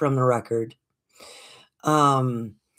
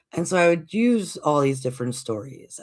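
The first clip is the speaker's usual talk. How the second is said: ordinary delivery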